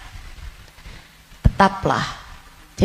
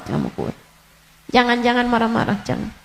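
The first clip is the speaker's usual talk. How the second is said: usual speaking voice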